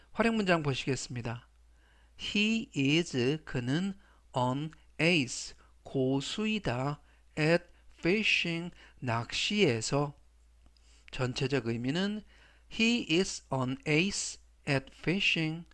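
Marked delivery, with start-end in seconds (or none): 2.2-8.7 slow model reading. Shown as a demonstration. Teacher reads example phrase slowly. 12.7-15.7 very slow, each word drawn out; slow, careful teacher's model sentence; slow model reading. Shown as a demonstration. Teacher reads example sentence slowly.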